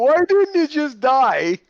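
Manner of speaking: high, slow, whiny put-on voice; mocking whiny airman. Retelling the airman who just hit a deer, high whiny mocking voice. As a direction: parody a distraught young Californian kid. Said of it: "That's what he sounded like".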